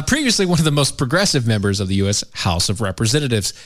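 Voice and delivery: ordinary delivery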